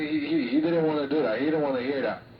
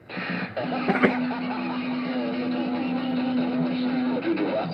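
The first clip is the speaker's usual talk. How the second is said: long laugh; 13 semitones higher than usual. Real, not acted. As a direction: laugh hard at what the radio just said. Laughing at radio chatter.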